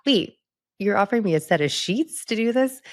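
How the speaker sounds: high, disbelieving question; incredulous. Retold or imitated. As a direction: retell the shock, pitch jumping up. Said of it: "I was like".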